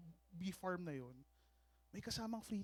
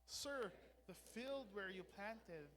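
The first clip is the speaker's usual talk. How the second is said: scripture reading; 2 semitones lower, 16 dB quieter than usual. Real, not acted. Pastor reading the parable with the congregation, hushed scripture reading. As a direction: read scripture softly, phrase by phrase.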